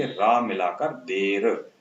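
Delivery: slow, drawn-out रा before देर; slow blending. Shown as a demonstration. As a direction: stretch रा, then close on देर. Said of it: "दे पढ़ेंगे".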